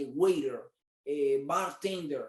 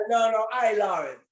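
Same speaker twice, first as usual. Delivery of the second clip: louder, higher, laughing protest; playful loud defence of the heat; excited insistence; 4 semitones higher, 9 dB louder than usual. Real, not acted. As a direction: insist with real glee that you love it. Insisting again he loves heat.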